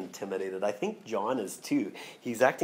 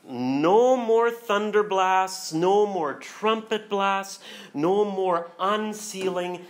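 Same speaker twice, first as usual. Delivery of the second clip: slow, louder, higher repeated "No more"; slow emphatic decree; 6 semitones higher, 5 dB louder, much slower than usual. Acted. Preacher reading the angel's decree, slow and emphatic. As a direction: hammer each "No more" slowly.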